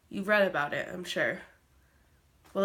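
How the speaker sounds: ordinary talk, nothing marked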